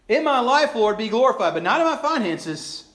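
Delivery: very high, loud praise with a hedge; mock devotion. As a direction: overdo the praise, then back out. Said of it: "we sing it half-heartedly".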